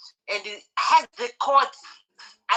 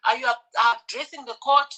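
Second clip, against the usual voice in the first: ordinary delivery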